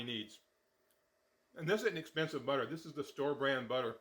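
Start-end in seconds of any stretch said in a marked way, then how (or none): none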